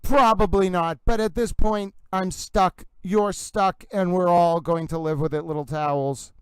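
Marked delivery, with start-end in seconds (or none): none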